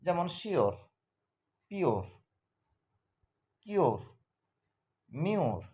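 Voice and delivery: শিওর, পিওর, কিওর, মিওর each ending in a clear "-or" sound; English sure, pure, cure said as shor, pyor, kyor; example words. Shown as a demonstration. Teacher models example words ending in অর. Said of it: "example".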